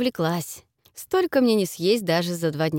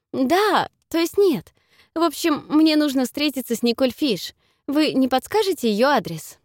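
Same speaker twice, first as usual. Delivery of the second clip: flustered; 6 semitones higher than usual. A narrator's reading. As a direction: blurt it out in a fluster. Flustered girl on the phone.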